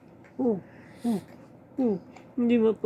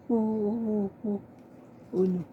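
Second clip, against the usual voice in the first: ordinary talk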